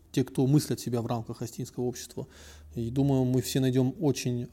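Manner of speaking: usual speaking voice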